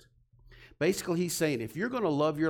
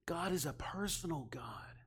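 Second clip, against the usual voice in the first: a soft, breathy whisper, every word unvoiced; 11 dB quieter than usual. Real, not acted. Preacher whispering the key truth to the congregation, intimate.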